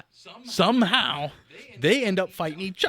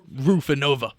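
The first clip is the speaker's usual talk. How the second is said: ordinary delivery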